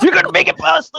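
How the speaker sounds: high loud mock wail; high comic cry. Friends joking about balloon man, high hammed-up cry. Voiced wife in comic scene.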